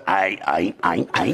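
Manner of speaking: a lightly done halting senator voice; Chuck Schumer impression. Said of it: "My Chuck Schumer impression".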